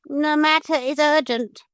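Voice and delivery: high, calm parrot voice. A narrator's reading. Parrot tells the gull leader, calm and high. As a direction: state it calmly despite the danger. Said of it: "but quite calmly".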